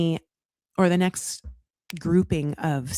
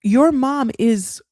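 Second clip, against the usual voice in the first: slowed, deliberate and louder; slow pointed jab; 3 semitones higher, 3 dB louder, slower than usual. Pretend. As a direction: spell it out slowly to Sophie. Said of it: "to tell Sophie".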